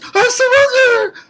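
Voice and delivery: very high, loud squealed plea; mock surrender cry. Reviewer imitating Cyrodiil faking surrender, high loud mock cry.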